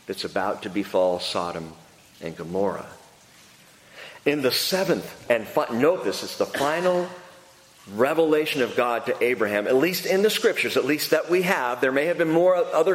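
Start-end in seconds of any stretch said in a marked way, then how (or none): none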